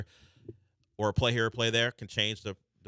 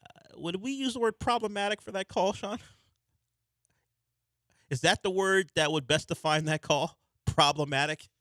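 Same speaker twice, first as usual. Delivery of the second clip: much higher, dry disbelief; mock-scandalized; 11 semitones higher than usual. Real, not acted. Host asking his producer about an awful call, mock-scandalized. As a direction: play it as appalled but amused. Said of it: "host reacting to offensive call".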